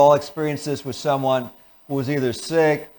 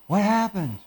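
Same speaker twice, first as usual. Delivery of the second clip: hushed, higher-pitched worried question; acted fearful concern; 5 semitones higher, 4 dB quieter than usual. Shown as a demonstration. Parent approaching a crying child, quietly worried. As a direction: ask it softly, with alarm. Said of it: "remember, you're using fear".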